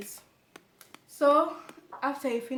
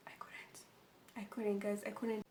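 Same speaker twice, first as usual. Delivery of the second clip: whispered, softer confession; quiet admission; 10 dB quieter than usual. Real, not acted. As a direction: let it drop to almost nothing. Confessing she couldn't walk.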